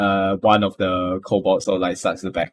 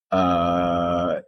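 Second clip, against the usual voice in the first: ordinary delivery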